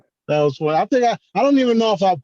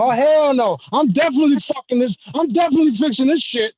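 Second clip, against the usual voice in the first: high, fast, fired-up hell no; fast, higher, indignant vow; defiant refusal; 10 semitones higher than usual. Retold or imitated. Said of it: "I'm like".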